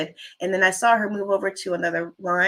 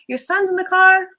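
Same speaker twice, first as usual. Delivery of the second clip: surprised question; 11 semitones higher, 8 dB louder than usual. Retold or imitated. Hearing her son waited outside.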